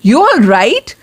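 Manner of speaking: "You all right" rising on the last word; "right" swept upward as a question; questioning tune. Shown as a demonstration. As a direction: ask it, voice climbing on "right". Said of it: "मैं question पूछ रही हूँ".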